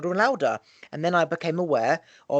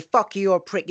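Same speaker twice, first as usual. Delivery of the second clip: higher, louder mock insult; 5 semitones higher, 3 dB louder than usual. Quoting someone who insults you, mock angry. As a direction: fling the insult in mock anger. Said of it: "if they say".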